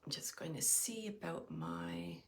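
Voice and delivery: breathy whisper, half to herself; whispered aside. Real, not acted. Reading the stream comments to herself, whispering. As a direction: murmur it under your breath as you look away.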